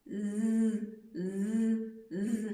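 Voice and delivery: drawn-out mosquito buzz on z; buzzing z sound. Shown as a demonstration. Teacher buzzing z for children like a mosquito. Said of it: "який звук видавали всі комарики".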